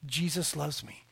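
soft, low-key imitation of a little boy; young son's answer. Son telling father what the cross means, quiet child imitation. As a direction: recite it quietly like a small child. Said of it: "What does the cross mean?".

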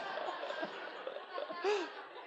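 airy laugh at his own joke. Real, not acted. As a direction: crack up after the silly insult. Laughs at his own lame insult.

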